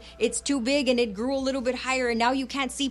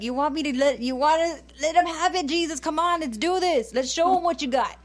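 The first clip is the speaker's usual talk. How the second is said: eager disciples egging on; 5 semitones higher than usual. Retold or imitated. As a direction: pump Jesus up for a fight like an overeager sidekick. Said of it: "And it's like".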